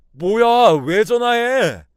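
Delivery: very loud, high, drawn-out yell; angry shouting. A narrator's reading. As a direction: explode at someone calling the police. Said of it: "소리를 지르면서". (버럭 소리 지르기)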